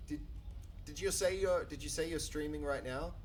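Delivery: usual speaking voice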